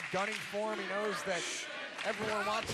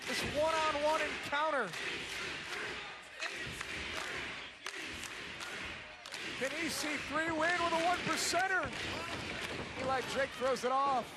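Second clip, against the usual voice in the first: lively ringside play-by-play; wrestling commentator; 5 semitones higher than usual. Acted. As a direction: call the near finish live. Live play-by-play.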